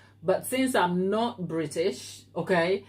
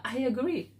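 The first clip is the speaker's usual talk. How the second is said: ordinary talk, nothing marked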